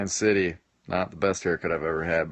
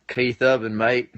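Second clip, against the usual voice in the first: amateur attempt at an Australian accent; 3 semitones higher, 4 dB louder, slower than usual. Shown as a demonstration. Streamer shows his accent to viewers, attempted Australian accent. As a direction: give your best go at an Australian accent. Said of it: "try my best on the accent".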